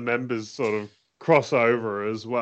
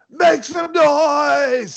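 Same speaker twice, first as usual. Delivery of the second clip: high drawn-out hype shout; wrestler shout; 9 dB louder, much slower than usual. Retold or imitated. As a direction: yell it to the crowd, high and slow. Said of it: "she just screams out".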